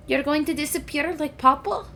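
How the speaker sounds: high, small fearful question; frightened kittens. Acted. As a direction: ask like scared little kids. Kittens fear losing brother.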